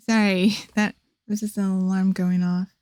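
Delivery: usual speaking voice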